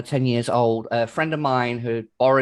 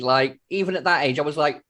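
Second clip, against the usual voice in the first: ordinary delivery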